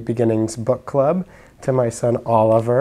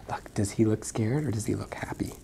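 whisper; 2 semitones lower, 8 dB quieter than usual. Real, not acted. Mother whispering to her son about the picture. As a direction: whisper it gently.

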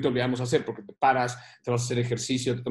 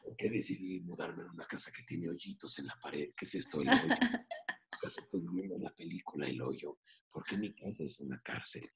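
much lower and far softer, near whisper; hushed mock misery; gloomy despair; 9 semitones lower, 12 dB quieter than usual. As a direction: mope like a prisoner in your own house. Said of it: "Y hay días que te levantas y dices".

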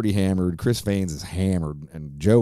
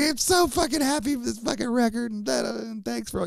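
high-pitched mock weepy gratitude; imitated crying; 3 dB quieter than usual. Tearful musician thanking his helper for the record, mock crying imitation. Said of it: "just crying and fucking, he's like".

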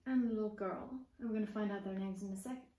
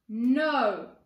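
loud refusal; 10 dB louder than usual. Acted. Grumpy brother refuses.

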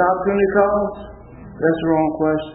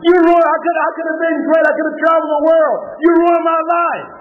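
a woman yelling through tears at the dance master; crying and yelling; 10 semitones higher than usual. Retold or imitated. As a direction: shout it out like your life was stolen. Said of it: "she started crying and started yelling".

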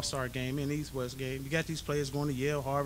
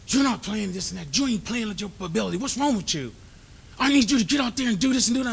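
loud, much higher, building scolding, fast at the end; coach chewing him out; 8 semitones higher, 8 dB louder than usual. Retold or imitated. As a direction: ramp up the sideline tirade.

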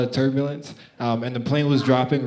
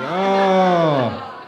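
long, raised "ohhh" of reaction; stretched-out, higher "oh"; drawn-out "oh"; 4 semitones higher, 4 dB louder than usual. Retold or imitated. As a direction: let one oh swell and hang. Reaction to cousin's bad joke.

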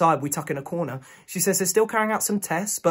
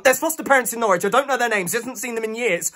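higher, urgent, impatient explanation; agitated arguing; 6 semitones higher than usual. Acted. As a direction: argue your way past the nurse. Agitated fiancée argues with nurse.

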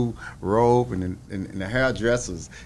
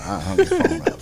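laughing through the words, voice jumps high. Real, not acted. Retelling hanging up, laughing.

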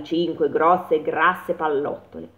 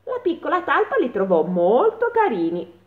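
ordinary delivery, nothing marked

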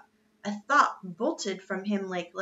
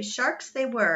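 usual speaking voice

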